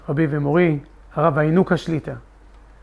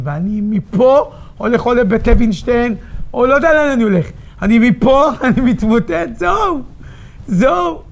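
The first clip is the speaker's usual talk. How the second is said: breaking down; 7 semitones higher, 4 dB louder than usual. Real, not acted. Describing his breakdown.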